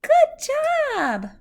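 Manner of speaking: high, loud burst of praise; delighted praise. Real, not acted. Parent praises the child for counting to three, bright delighted praise.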